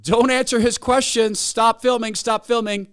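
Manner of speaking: high, loud frantic shout of stop; yelling across a square. Retold or imitated. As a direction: yell to stop him from across the plaza. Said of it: "yells from across Union Square".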